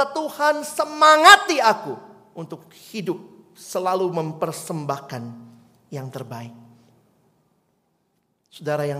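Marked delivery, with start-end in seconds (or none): 0.0-6.5 a rising, pleading prayer voice; mock prayer plea. Pastor models a prayer for the congregation, pleading. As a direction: plead to God as the congregation would.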